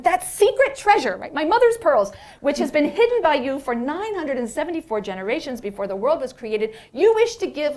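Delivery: high, loud outraged protest; indignant angels protesting. Acted. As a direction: demand how God could give it away.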